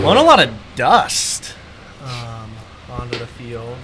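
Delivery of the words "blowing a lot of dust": higher, louder over the wind; shouting over wind. Real, not acted. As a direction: call it out over gusts. Talking over strong wind.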